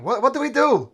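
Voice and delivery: high, loud, worried plea; anxious question. Acted. Mayor pleading with the group, high and worried. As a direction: ask it in a panic.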